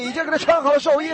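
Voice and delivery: mocking jeer. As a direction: sneer the words up high. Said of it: "他们就耻笑他".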